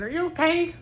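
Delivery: very high-pitched character voice; high eccentric character voice; Bobby Justin. Retold or imitated. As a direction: ask in a high, put-on voice. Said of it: "do a Bobby Justin impression".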